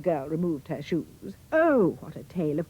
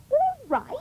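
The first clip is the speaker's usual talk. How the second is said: cried out. A narrator's reading. Cindy snaps at the fairy, high indignant cry. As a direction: fling it back in disbelief. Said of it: "cried Cindy".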